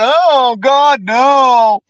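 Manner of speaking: high, loud sarcastic outcry; hammed-up sarcastic dismay; mock horrified shout. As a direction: fake appalled at the very idea.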